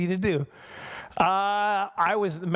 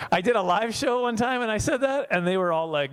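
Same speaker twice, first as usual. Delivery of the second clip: ordinary talk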